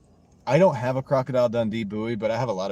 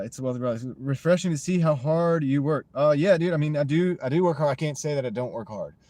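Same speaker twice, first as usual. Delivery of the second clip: usual speaking voice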